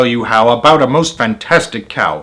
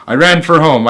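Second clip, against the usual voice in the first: usual speaking voice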